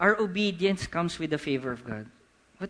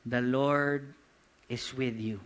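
slow repeat; 4 semitones lower, slower than usual. Shown as a demonstration. Preacher repeating the key word to his congregation, slow and grave. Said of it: "I'm gonna say it again".

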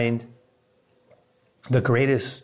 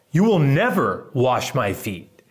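slow, forceful refusal as Peter; acted-out adamant protest; emphatic refusal; 3 semitones higher, 4 dB louder, slower than usual. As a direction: punch it out, drawing the words long. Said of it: "It's emphatic".

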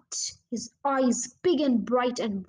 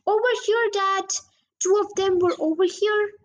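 calling out; 7 semitones higher, 4 dB louder than usual. A narrator's reading. Calling across dark wood.

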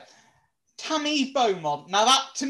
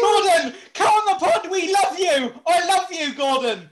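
loud, high shout to Gordon; calling out; 7 semitones higher, 6 dB louder than usual. Real, not acted. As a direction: holler across to Gordon, begging.

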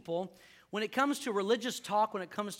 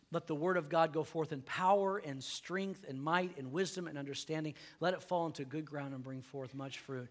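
soft, lowered prayer voice; 5 semitones lower, 6 dB quieter than usual. Acted. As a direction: pray quietly over the congregation. Pastor opens in prayer.

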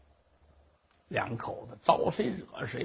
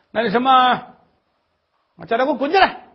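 loud, high nagging tirade; shrill scolding old mother; 6 semitones higher, 10 dB louder than usual. Acted. Mother-in-law scolds the crying daughter-in-law, loud and high.

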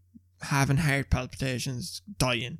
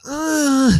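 high, loud "ugh" of disgust; retold disgusted groan; 11 semitones higher, 6 dB louder than usual. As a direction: groan as if reliving the mess. Reacting to dog mess in retelling.

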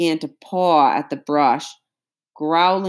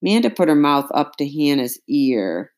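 plain reading voice

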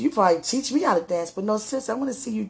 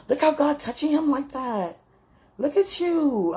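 high-pitched, gushing amazement; retold admiring delight; 6 semitones higher than usual. Woman recalling watching her sweetheart touched by God, admiring delight. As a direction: marvel aloud in a raised voice.

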